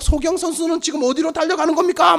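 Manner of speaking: high, running race commentary; sports commentator. Acted. Commentator calls patients racing to the pond, mock play-by-play. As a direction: call it like a live race, pitch held high. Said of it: "중계가 시작될 거예요".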